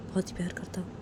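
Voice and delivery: soft unvoiced whisper; father whispering comfort to his daughter; tender whisper. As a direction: breathe it to the child.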